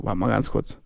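low, soft, steadied request; retold composing himself. Boyfriend needs a moment after news, retold hushed composure. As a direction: take a breath, then ask quietly. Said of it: "ich hab kurz geatmet".

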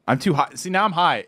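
ordinary talk, nothing marked

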